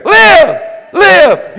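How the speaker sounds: high, very loud command; shouted declaration. Shown as a demonstration. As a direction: declare it at full shout, word by word. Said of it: "you go back over it and you declare".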